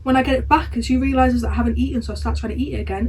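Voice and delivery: ordinary talk, nothing marked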